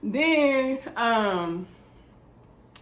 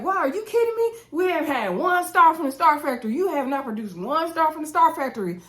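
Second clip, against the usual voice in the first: high-pitched, emphatic indignant comeback; mock outrage; 7 semitones higher than usual. Blogger retells Anthony's Star Factory jab, mock outraged. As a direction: imitate an affronted man scoring a point.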